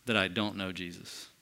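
low, soft, grave denial; hushed oath. Retold or imitated. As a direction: let the oath sink low and quiet. Peter's oath retold, sinking.

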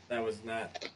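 throat catching, clearing it; husky, clearing the throat; frog in throat. Real, not acted. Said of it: "I got a frog in my throat".

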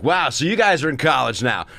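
loud, high, hammed-up stand-up delivery; mocking Remember When comic. Hack comic reminiscing to college kids, mocking loud stage voice. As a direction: lampoon a hacky nostalgia act working the crowd. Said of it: "They were all these Remember When guys".

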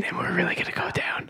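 hushed cabin announcement; whispered airline voice. Pretend. ASMR airline bit continues.

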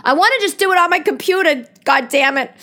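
high, loud and fast in mock exasperation; exasperated outburst. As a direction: retell her own frustrated outburst. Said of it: "So I said".

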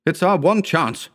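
high, pressing cry; urgent cry. A narrator's reading. Howard urging flight from danger, a high urgent cry. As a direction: cry it out to rally the others. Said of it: "cried Howard".

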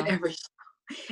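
soft unvoiced aside; whispered echo. Real, not acted. Host echoing her cohost's quip, whispered. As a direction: whisper it back like a punchline.

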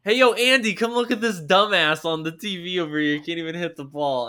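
loud, high heckle from the couch; calling out mockingly. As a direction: holler to a buddy in the next room, easing off by the end. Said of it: "someone's at home like".

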